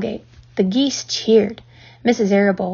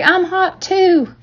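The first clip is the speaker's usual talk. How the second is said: put-on drawn-out, pitched-up kid shout; eager cry; 9 semitones higher, much slower than usual. A narrator's reading. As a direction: call out begging to join in. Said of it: "cried Avery".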